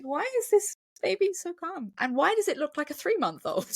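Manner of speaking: high-pitched incredulous questioning; baffled mock question. Viewer questioning the film's calm baby, high baffled disbelief. Acting own baffled reaction to film.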